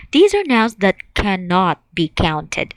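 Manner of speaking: ordinary talk, nothing marked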